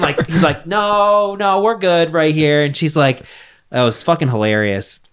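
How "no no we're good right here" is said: higher, drawn-out parody no; mock nervous refusal. Plumber fends off the lady, mock uneasy refusal. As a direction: back away with a hammed-up refusal.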